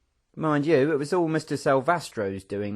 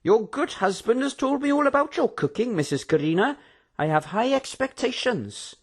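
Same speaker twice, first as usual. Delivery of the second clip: polite prisoner; 10 semitones higher than usual. A narrator's reading. Barricus flatters hostess.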